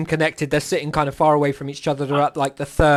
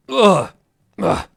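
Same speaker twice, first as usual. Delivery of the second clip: tipsy groan; 3 semitones higher, 3 dB louder than usual. Acted. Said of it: "just says to him".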